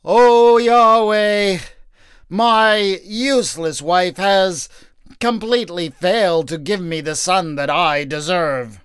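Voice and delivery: overwrought pleading to God, loud at first; a put-on weepy cry to heaven; hammed-up tearful prayer. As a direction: ham up a tearful plea to the Lord. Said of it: "dig down deep so as to be really convincing".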